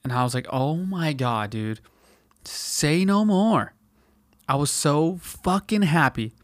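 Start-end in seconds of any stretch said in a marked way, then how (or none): 0.5-3.7 slow drawn-out relief; elated slow sigh of relief; relieved, slow. Retold or imitated. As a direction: stretch it out in grateful relief. Relieved at being let go.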